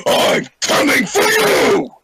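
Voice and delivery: loud, high, drawn-out war cry; full-out barbarian yell; battle yell. Acted. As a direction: bellow it charging at a monster. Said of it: "he yells out".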